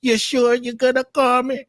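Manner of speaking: high put-on character voice; Elder Mother. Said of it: "Like, Elder Mother".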